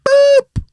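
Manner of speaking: very high short boop; high squeak sound effect. Retold or imitated. Imitated sound of the shot.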